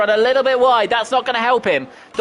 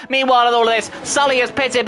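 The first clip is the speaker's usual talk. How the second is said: normal talking voice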